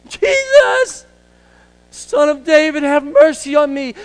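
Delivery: very high, loud screeched cry for mercy; crow-like screech. Acted. Blind beggar screeching to Jesus for mercy, full-force high cry. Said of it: "the word says he screeched".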